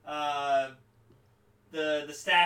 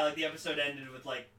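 normal talking voice

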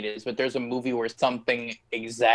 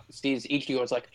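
ordinary delivery

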